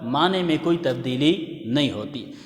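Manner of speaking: normal talking voice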